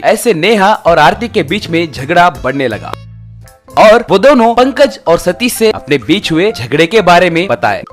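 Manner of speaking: usual speaking voice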